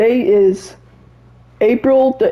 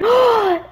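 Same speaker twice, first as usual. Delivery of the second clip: sharp, high-pitched gasp; excited gasp; 13 semitones higher than usual. Real, not acted. Player spots the new fishy skin, excited gasp.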